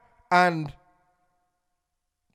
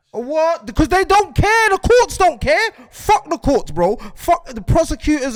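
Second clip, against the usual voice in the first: higher, louder angry rant at the courts; heated outburst; 9 semitones higher, 6 dB louder than usual. Real, not acted. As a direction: let the anger boil over, really fed up. Ranting about the courts.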